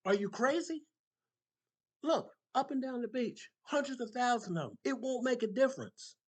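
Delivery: high, loud, scoffing disbelief; incredulous shout. Acted. Stranger shouting at the man saving starfish, mocking yell.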